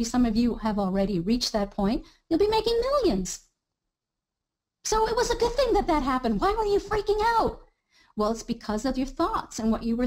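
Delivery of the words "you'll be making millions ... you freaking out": excited high voice. Acted. Exaggerated cheerful payoff.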